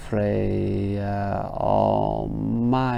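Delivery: Freya Almighty drawn out very slowly, syllable by syllable; slow scanning. Shown as a demonstration. Teacher scanning the half line, very slow. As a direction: stretch out Freya Almighty to show the feet. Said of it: "if you just scanned it normally".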